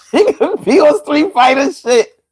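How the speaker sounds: high-pitched words through real laughter; laughing. Real, not acted. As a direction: crack up while repeating a joke. Laughing at a viewer's joke.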